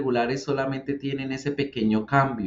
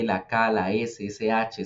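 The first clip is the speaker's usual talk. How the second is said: ordinary delivery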